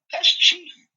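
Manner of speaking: loud, high-pitched mock outrage; retold incredulous outburst; shocked protest. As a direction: squeal it as a comic objection. Retold protest at deer feeder.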